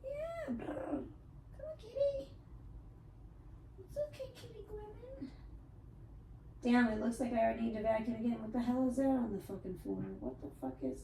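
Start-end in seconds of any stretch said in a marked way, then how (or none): 0.0-5.3 much higher, cooing pet voice. Real, not acted. Baby talk to cat.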